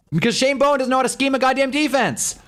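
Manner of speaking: high-pitched fed-up rant at the coordinator; exasperated rant. Real, not acted. Fan venting to viewers about the Giants defensive coordinator, high exasperated rant.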